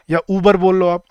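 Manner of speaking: ordinary delivery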